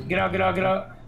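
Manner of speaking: ordinary talk, nothing marked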